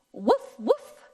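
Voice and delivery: dog bark. Acted. Teacher reading to kids, voicing the dog's bark.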